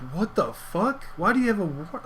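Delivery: high-pitched incredulous questioning; retold disbelief. Reacting to a friend's warrant in high-pitched disbelief. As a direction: mimic your own stunned, high-pitched reaction.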